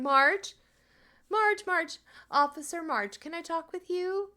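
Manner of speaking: high, sing-song call for Officer Marge; sugary neighbour voice. Acted. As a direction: neighbour lady sweetly calling Officer Marge. Calling Marge, sweet neighbour voice.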